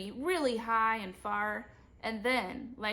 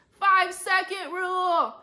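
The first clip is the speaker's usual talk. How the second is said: high, loud excited call; shout; 10 semitones higher, 9 dB louder than usual. A narrator's reading. Boy claiming dropped cake, shouting. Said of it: "I shouted".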